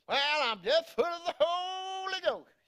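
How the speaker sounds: high, exaggerated American holy-roller; American accent. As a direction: stretch it out in a mock American accent. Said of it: "I'm doing an American accent there".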